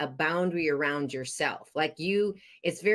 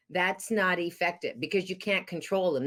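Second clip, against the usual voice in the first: ordinary talk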